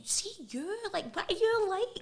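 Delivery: high, loud, giggly protest; laughing protest. Retold or imitated. Laughing him off while he forces food on her, high and giggly. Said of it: "And I'm laughing and going".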